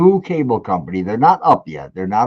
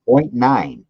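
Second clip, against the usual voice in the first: normal talking voice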